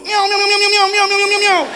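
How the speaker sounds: high, loud mewing kittens; squeaky mock kitten meowing; high kitten meows. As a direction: mew like a box full of kittens. Imitating kittens in the box.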